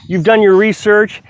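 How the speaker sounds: ordinary delivery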